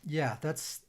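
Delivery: usual speaking voice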